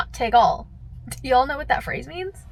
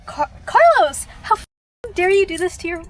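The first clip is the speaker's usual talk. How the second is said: outraged disbelief; 7 semitones higher, 8 dB louder than usual. Real, not acted. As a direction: call Carlos out, stretching his name in disbelief. Outraged at driver Carlos.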